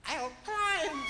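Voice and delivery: very high-pitched little animal voice; squeaky-high quick retort; defiant small critter. Acted. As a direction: pipe it up as a tiny challenger. Small animal answers the bear.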